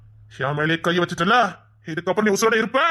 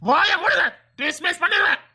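raised, higher voice barking the threat; angry shout; 5 semitones higher than usual. Acted. Officer yelling at his subordinate, angry shout. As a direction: snap him into silence with a threat.

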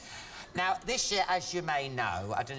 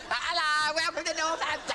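much higher-pitched silly townsperson voice; caricature Northampton local; 12 semitones higher than usual. Acted. Comic mocking his hometown's people for laughs, silly high voice. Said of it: "they must think everyone in Northampton".

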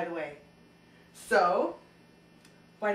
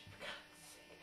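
whispered grumble; 24 dB quieter, much slower than usual. Acted. Host grumbles aside.

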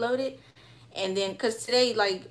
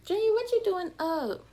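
surprised; 11 semitones higher than usual. Real, not acted. Calling out to her son.

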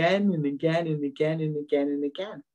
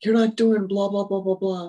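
louder, higher mock complaint; mental ranting; 4 semitones higher, 4 dB louder than usual. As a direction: rattle off a parody of inner griping. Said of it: "then I went through all the mental things".

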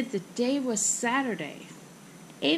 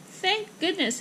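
high-pitched, mild relief; relieved; 8 semitones higher than usual. Acted. Mother reaches theater in time, mild relief. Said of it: "sighed in relief".

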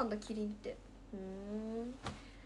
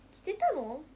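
驚きの問いかけ; 4 semitones higher, 6 dB louder, slower than usual. Real, not acted. リスナーの存在に驚く.

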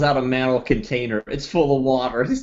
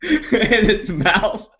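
slow, laughing through it; 9 semitones higher, much slower than usual. Real, not acted. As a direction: crack up mid-line. Laughing while describing.

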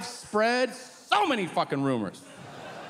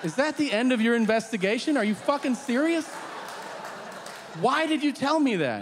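incredulous disbelief; 6 semitones higher than usual. Retold or imitated. Exasperated son confronting his dad.

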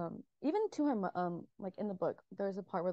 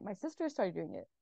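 ordinary talk

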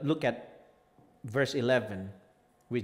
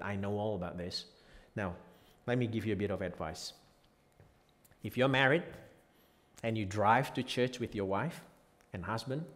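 ordinary delivery

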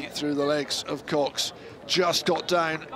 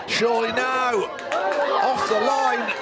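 excited shout; 5 semitones higher than usual. Real, not acted. Near goal chance.

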